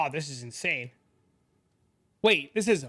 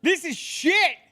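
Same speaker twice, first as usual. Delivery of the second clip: loud, high, slow exasperated outburst; frustrated yelling; 7 dB louder, slower than usual. Real, not acted. Streamer yells at a terrible item drop, higher and louder. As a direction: drag it out in loud disbelief.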